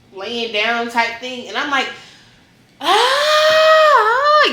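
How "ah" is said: high loud mock yell of pain; retold yell. Patient yelling at doctor in recovery, high loud mock yell. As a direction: belt out a hammed-up yell. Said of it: "yelling and all that stuff".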